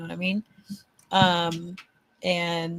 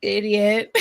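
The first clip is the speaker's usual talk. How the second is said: mock scolding; 4 semitones higher, 8 dB louder, much slower than usual. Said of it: "It was like".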